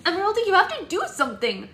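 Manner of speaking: a bride begging the fairy for help; desperate plea. A narrator's reading. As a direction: beg the fairy loudly. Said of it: "Red said".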